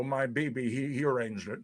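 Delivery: ordinary talk, nothing marked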